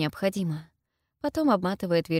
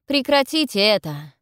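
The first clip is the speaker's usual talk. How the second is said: raised, forceful order to stop; loud, higher protest; loud demand; 4 semitones higher, 7 dB louder than usual. A narrator's reading. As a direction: demand firmly, voice lifted.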